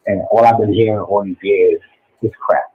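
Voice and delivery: normal talking voice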